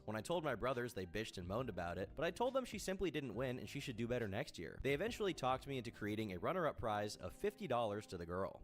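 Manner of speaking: ordinary talk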